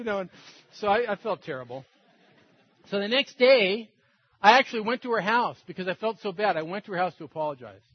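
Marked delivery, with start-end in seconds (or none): none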